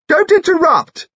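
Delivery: high, loud imperious rebuke; shouting. A narrator's reading. Eccentric dowager rebukes the lord, shouting. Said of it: "shouted Lucy Lorimer".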